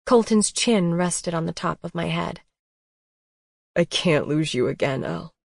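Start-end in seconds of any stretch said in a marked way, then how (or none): 3.8-5.3 a hint of a lower man's voice; lightly voiced Colton. A narrator's reading. Colton holding her, afraid to lose her.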